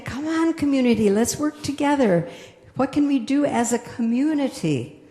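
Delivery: rally the town like the mayor. Retold or imitated. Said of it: "he was saying".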